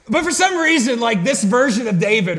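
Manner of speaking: real laugh carried into high, loud speech; high-pitched, loud and cracking up; amused laughing. Real, not acted. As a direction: talk through your own laughter. Laughs at own accidental accent.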